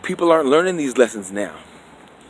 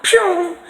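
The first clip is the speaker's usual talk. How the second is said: zoom sound. Retold or imitated. Sound effect of running off.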